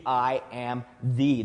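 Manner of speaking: "I am the" said slowly, each word weighted; stress on I AM THE. Shown as a demonstration. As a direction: spell out "I am the" word by word. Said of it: "it's on the".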